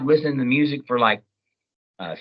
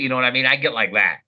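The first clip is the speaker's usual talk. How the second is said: normal talking voice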